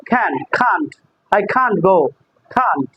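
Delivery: "can" then "can't", softly and carefully; teacher modelling can versus can't; pronouncing can and can't. Shown as a demonstration. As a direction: model "can't" with a clear final t, quietly. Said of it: "এই উচ্চারণটা বলবো".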